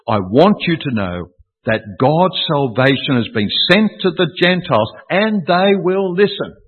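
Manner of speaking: emphatic preacher's proclamation, building in pitch; emphatic declaration. Acted. Preacher quoting Paul to the congregation, emphatically. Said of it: "this is emphatic".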